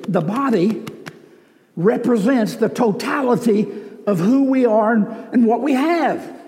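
full-force sermon delivery; preaching. Acted. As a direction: drive the point home to the church.

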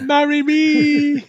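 very high, drawn-out mock plea; jokey wailing proposal; mock desperate plea. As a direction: stretch it out high like a pleading suitor. Joking desperate proposal after "don't jump.

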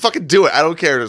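loud, high exasperated dare; fed-up outburst. Acted. Rose calling the actress's bluff, fed up. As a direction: snap back with zero patience.

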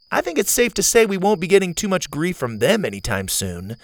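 a hint of a spunky girl; Zeta girl voice.

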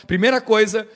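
ordinary talk, nothing marked

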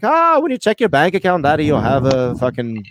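put-on Irish accent sliding toward Scottish; dodgy Irish accent. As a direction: put on an uncertain stage-Irish lilt. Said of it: "don't even know if that's Scottish or Irish".

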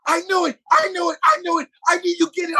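high, excited repeated exclamations; overjoyed. Retold or imitated. Actor hugging his friend who got the part, overjoyed. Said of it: "he was so happy for me".

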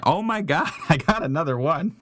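laughing disbelief. Real, not acted. Rolls a second one.

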